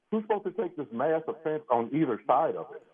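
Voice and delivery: normal talking voice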